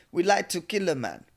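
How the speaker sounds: put-on retry the Russian accent, thicker. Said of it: "How do Russians sound?".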